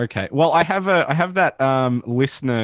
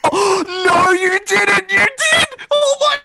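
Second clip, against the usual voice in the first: shocked shriek; slower than usual. Real, not acted. Cohost shrieking in shock that the guest was kicked. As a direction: shriek in horror at what he did.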